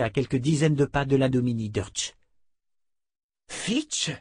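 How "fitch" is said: high startled exclamation; surprised cry. A narrator's reading. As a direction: recognize an old friend in shock. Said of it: "s'écria Beata, les yeux ronds".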